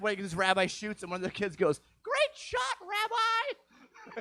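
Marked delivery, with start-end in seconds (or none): 2.0-4.2 put-on very high child's cheer; kid's cheer. Kid cheering the rabbi's shot in a high child voice. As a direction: squeal it like an excited little kid.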